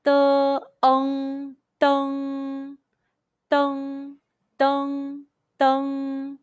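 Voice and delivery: "De, ong, dong" blended, then "dong" repeated, high and level; high first tone drill. Shown as a demonstration. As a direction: hold each "dong" long on one steady pitch. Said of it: "high pitch first tone all together".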